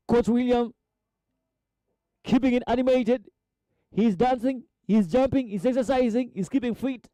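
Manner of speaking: high-pitched, excited listing; animated commentary. Real, not acted. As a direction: build a lively run of quick phrases up high. Commentator describes animated coach.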